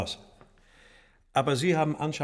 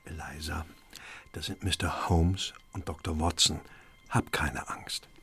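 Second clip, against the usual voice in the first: low, hushed bedside voice; gently reassuring; 8 semitones lower, 3 dB quieter than usual. Acted. Bruder beruhigt seine todkranke Schwester.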